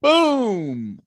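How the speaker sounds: loud, high, drawn-out boom; imitated gunshot boom. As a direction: mimic another shot echoing far off. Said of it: "I hear boom".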